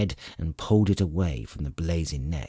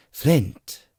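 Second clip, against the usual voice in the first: panted; 7 semitones higher, 4 dB louder than usual. A narrator's reading. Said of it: "he panted".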